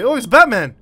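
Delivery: loud excited exclaim. Real, not acted. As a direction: burst out excited. Excited discovery.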